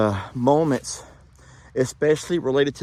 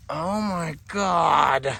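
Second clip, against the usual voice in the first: slow, hushed awe; awed gasp; 4 semitones higher, much slower than usual. Real, not acted. Vlogger finding a soldier's grave, slow awed. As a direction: let the discovery stop you.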